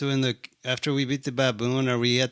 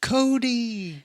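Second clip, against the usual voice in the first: mock character voice; 10 semitones higher than usual. Imitating the character name.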